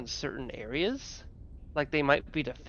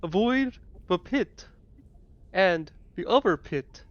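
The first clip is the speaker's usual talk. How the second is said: higher, slower walrus creature; retry the walrus voice, slower and higher; 3 semitones higher, 5 dB louder, slower than usual. Acted. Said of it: "I don't like that voice".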